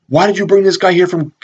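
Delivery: high-pitched mock tirade; reaming someone out. Police captain chewing out his cops, mock yelling. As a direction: imitate an irate boss's dressing-down. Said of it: "the captain's reaming them out".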